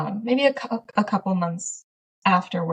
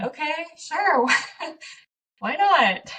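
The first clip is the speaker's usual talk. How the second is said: high, slow, bemused acceptance; laughing at an odd request; amused surprise; 8 semitones higher, slower than usual. Retold or imitated. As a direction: shrug it off with a laugh. Retold reaction to camera gift.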